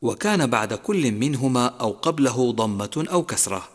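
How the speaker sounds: ordinary delivery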